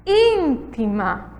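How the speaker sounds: íntima drawn out slowly on the opening ÍN; stress on first syllable. Shown as a demonstration. Teacher showing the tonic syllable, íntima stressed at the start. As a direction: stretch the ÍN of íntima, let the rest fall away. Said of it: "É a sílaba tônica".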